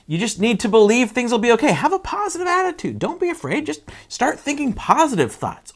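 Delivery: high, fast sing-song mock positivity; chirpy mock-optimistic voice; mocking cheerful. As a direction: parody empty pep talk. Mocking shallow advice.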